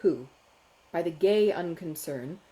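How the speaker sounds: plain reading voice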